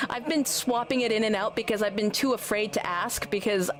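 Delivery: ordinary talk, nothing marked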